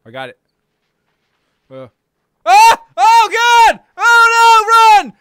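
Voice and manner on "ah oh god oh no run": high loud panicked shouts; panicked yelling. Real, not acted. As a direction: yell in real panic as it attacks. Panicked yelling at monster attack.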